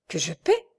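dazed disbelief, rising at the end; stunned question. A narrator's reading. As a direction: echo it as if dumbfounded. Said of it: "dit Michel abasourdi". (question abasourdie, incrédule)

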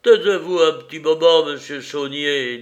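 stern order to be silent; commanding. A narrator's reading. Stops the duel, commanding.

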